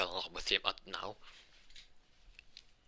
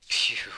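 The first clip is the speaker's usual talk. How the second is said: loud exhaled phew of relief; relieved phew; 6 dB louder than usual. Acted. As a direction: blow out a mock sigh of relief. Acted sigh of relief.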